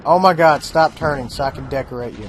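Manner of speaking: frustrated outburst. Real, not acted.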